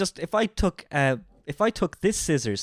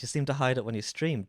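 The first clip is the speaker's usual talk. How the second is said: usual speaking voice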